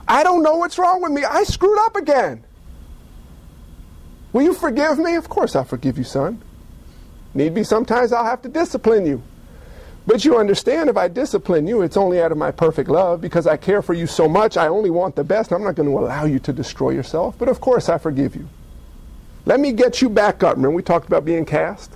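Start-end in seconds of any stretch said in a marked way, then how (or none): 0.1-5.2 high, anguished confession; pleading, distraught son. Acted. Erring son pleading with his father. 5.2-20.6 low, tender, forgiving voice; reassuring father. Acted. Forgiving father reassures his son.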